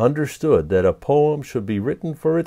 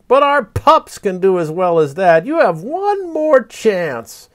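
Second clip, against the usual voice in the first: a hint of a haughty fox; lightly voiced fox; 8 semitones higher, 4 dB louder than usual. A narrator's reading. Fox dismissing the poet's haiku, lightly voiced. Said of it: "it called over his shoulder".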